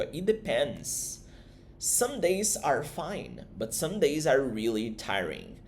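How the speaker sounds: "It depends" and the rest drawn out slowly, word by word; slow model answer. Shown as a demonstration. Teacher voicing Matthew's answer, slow and careful. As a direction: read the answer slowly for the class. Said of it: "o Matthew responde".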